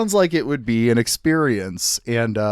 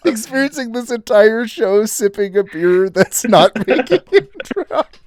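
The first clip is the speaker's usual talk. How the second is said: laughing; 7 semitones higher than usual. Real, not acted. Laughing at the idea.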